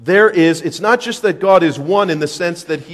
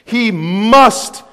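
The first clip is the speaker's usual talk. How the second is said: forceful preaching; 4 semitones higher, 7 dB louder than usual. Acted.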